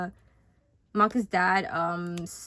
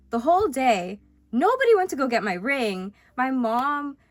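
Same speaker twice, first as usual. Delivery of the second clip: real indignation, louder and higher; heated; 5 semitones higher, 8 dB louder than usual. Real, not acted. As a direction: vent with rising exasperation. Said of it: "I'm heated".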